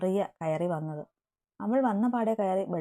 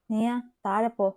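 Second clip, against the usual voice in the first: louder, curt command; changed tone; 3 semitones higher, 4 dB louder than usual. Acted. Eby ordering Nia out a second time, curt. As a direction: cut her off, firmly. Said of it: "അവൻ്റെ സ്വരം മാറിയതും".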